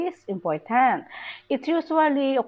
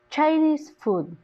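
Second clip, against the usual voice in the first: "Chinese" high, dropping on "food"; stress on CHINESE; 5 semitones higher than usual. Shown as a demonstration. Teacher gives an adjective-noun example, stress on "Chinese". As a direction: give "Chinese" the main stress. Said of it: "The adjective takes the main stress".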